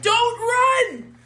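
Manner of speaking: high, loud mock parental yell; shouted warning. Acting a parent yelling a negative.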